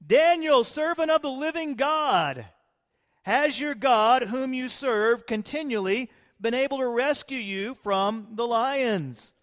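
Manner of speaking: high, loud desperate calling out; anguished cry. A narrator's reading. King calling into the lions' den in anguish. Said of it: "he cried out in anguish to Daniel".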